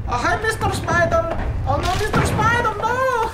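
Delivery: put-on high, wailing Eastern European kid accent; distraught Eastern European child. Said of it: "little Eastern European child".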